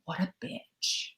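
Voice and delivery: slow, soft whispered jab; bitching quietly to friends after a bump, whispered; secret whisper. Retold or imitated. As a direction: whisper a catty insult behind their back. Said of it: "secretly, quietly to your friends".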